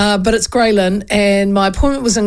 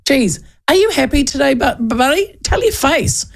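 sarcastic jibe; 7 semitones higher than usual. Retold or imitated. Retold snarky remark to cashier.

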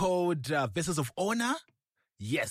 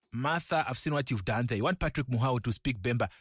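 ordinary talk

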